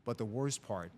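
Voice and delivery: ordinary talk, nothing marked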